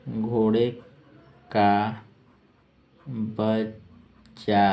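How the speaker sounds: घोड़े, का, बच्चा one by one with long pauses; slow dictation. Shown as a demonstration. Teacher dictating the meaning of colt, word by word. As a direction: draw out बच्चा, split in two.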